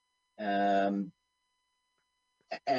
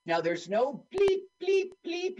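scanner beeps. Retold or imitated. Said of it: "universal product code scanners".

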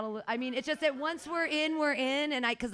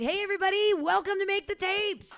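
loud, high showman welcome; hype shout; 5 semitones higher, 5 dB louder than usual. Acted. Host greeting the room, shouting with hype. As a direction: pump up the crowd with a big shout.

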